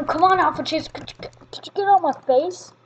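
ordinary talk

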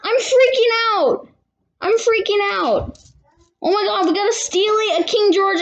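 can barely contain the thrill of the find; squealing with real excitement over rare coins; freaking out. Real, not acted. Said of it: "I was freaking out before".

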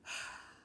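heavy breathy sigh; exasperated sigh. A narrator's reading. Father losing patience with daughter, exasperated sigh. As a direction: let out a long sigh before scolding. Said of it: "Mister Hartwell sighed".

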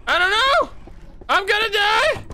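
high, loud terrified yell; panicked screaming. Real, not acted. Chased by monster, screaming.

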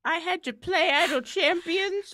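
lightly voiced cranky grandparent; grumpy old-timer. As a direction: grumble like a hint of an old-timer. Grumpy old-timer bit, back in my day.

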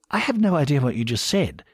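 polite British bafflement; upper-class British. A narrator's reading. Bernard puzzles over the slang, upper-class British. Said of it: "his thoroughly upper-class British voice".

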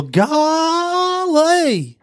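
high, drawn-out comic yokel exclamation; Gomer Pyle. Retold or imitated. Host cues the next actor with his catchphrase, high drawn-out impression. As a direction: stretch it way up like a goofy TV bumpkin. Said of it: "Gomer Pyle".

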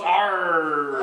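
drawn-out pirate growl; One-Eyed Jack. Shown as a demonstration. Leader shows players One-Eyed Jack, pirate growl. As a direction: growl a long pirate arr. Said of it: "and he goes".

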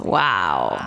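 low echoing exclamation; echo effect. Shown as a demonstration. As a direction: wow with heavy echo. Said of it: "Efecto eco".

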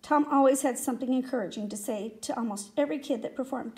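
usual speaking voice

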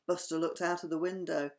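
plain reading voice

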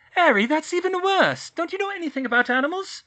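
high, loud and fast scolding; exasperated shriek. A narrator's reading. Hermione scolds Harry about dragons, high, loud, fast. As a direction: scold him, still shrieking. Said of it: "Hermione shrieked".

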